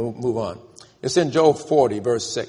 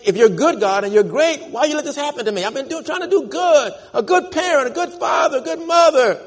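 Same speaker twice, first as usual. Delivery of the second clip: high, loud aggrieved plea; complaint to God; 12 semitones higher, 3 dB louder than usual. Retold or imitated. A suffering parent crying out to God, high aggrieved plea. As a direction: plead with God, high and wounded.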